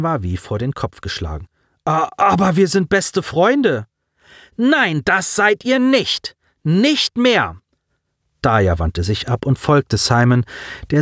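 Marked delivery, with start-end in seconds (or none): 1.8-3.9 stunned protest. Acted. 4.6-7.6 high, slow, cutting denial; harsh rejection. Acted. Daya cuts Colin off.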